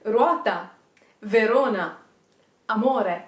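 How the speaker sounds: Ruota, Verona, amore with trilled r; rolled r demo. Shown as a demonstration. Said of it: "like this".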